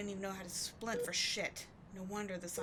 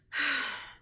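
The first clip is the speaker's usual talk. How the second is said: exasperated sigh; 6 semitones higher, 7 dB louder than usual. Acted. Exasperated sigh after outburst.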